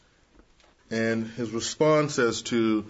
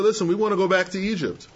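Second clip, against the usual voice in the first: complaining Israelites; 7 semitones higher, 3 dB louder, faster than usual. Retold or imitated. Preacher voicing Israelites' complaint.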